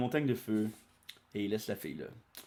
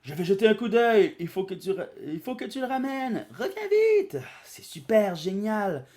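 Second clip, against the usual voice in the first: higher, louder excited chatter; high cartoon voices; 11 semitones higher, 8 dB louder than usual. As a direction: voice the kids bright and excited. Bulma and Goku chatter.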